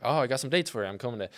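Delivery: normal talking voice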